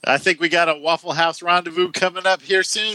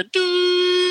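mock heart attack cry; 13 semitones higher than usual.

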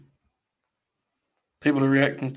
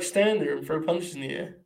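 ordinary talk, nothing marked

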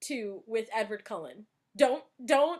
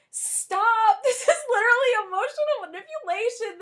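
laughing protest; 9 semitones higher, 7 dB louder than usual. Retold or imitated. Own reaction quoted.

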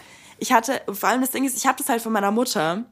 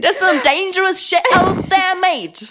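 mock Aussie accent; 9 semitones higher, 4 dB louder, slower than usual.

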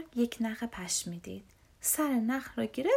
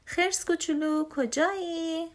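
raised, high searching call; 6 semitones higher, 4 dB louder than usual. Acted. Big brother searching the woods for little bear, calling out. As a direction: call out for someone lost.

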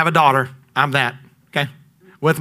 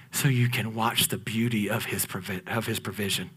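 much lower and softer, almost breathed; hushed awe; 9 semitones lower, 12 dB quieter than usual. Acted. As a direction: let it fall to a hush. Drops to near whisper.

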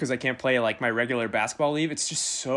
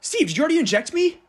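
shocked yell; 13 semitones higher, 6 dB louder than usual. Acted. Shocked patient yelling at nurse.

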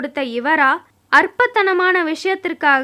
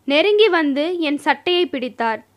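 ordinary delivery

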